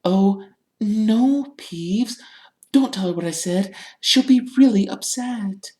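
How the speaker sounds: high, urgent hushed pleading; frantic Hermione. A narrator's reading. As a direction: plead anxiously in a high, thin voice. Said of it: "Hermione whispered frantically".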